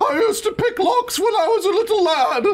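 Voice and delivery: put-on English accent. Voicing character, high.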